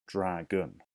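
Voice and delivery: "Dragon" in two distinct beats; syllable by syllable. Shown as a demonstration. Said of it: "that sounds like two".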